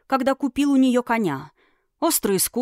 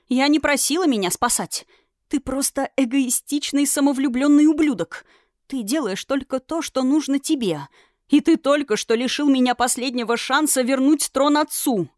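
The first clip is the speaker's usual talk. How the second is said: furious tirade; 5 semitones higher than usual. Acted. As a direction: pour out the insults in rage. Princess raging at the dragon.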